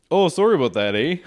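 loud Minnesotan eh; apologetic Minnesota singsong; Minnesotan accent. Pretend. Officer apologizing to traveller, Minnesotan accent. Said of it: "it's very Minnesotan".

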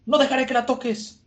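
high, strained vow in a different voice; defiant vow. Acted. Igaram vowing to protect the princess, high defiant voice.

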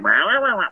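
imitated kitten talk. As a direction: babble back at the cat in its language. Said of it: "I think I speak like a kitten".

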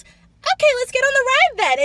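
bright customer-service cheer; high perky let's get on the ride; chirpy cast member. Retold or imitated. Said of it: "And then she goes".

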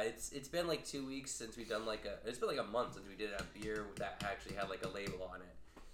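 quiet, close ASMR talk; soft ASMR voice. Acted. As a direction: ease into a soft ASMR murmur. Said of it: "I was amping up the A S M R".

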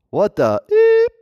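high, slow squeaked shock; mock shock at meeting him at the airport. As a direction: squeak it out in disbelief.

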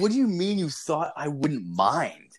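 incredulous, voice climbing high; incredulous retort. Retold or imitated. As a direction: throw the excuse back in retold outrage. Retold outraged reply to taser excuse.

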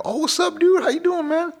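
hello called out way up high; cheery greeting. Retold or imitated. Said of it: "I could go places and be like".

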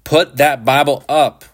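exasperated shouted order. A narrator's reading. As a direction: raise the voice and order him sharply. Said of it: "Shepard shouted".

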